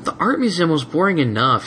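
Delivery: high moaning complaint; bored dragon moaning. Acted. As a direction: whine to the sky. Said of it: "he moaned".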